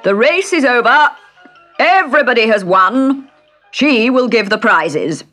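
high, loud announcing dodo; calling out. Acted. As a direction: proclaim it to the crowd. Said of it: "the dodo suddenly called out".

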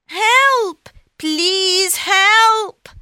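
high, loud cry for help; shouting for help. Acted. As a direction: yell it out to the passing coach. Cat calls for help.

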